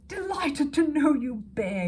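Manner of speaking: slow, loud, hearty boom. A narrator's reading. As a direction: boom it out grandly. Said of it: "he boomed".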